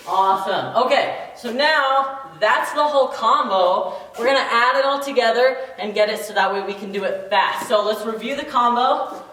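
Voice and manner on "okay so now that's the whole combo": ordinary talk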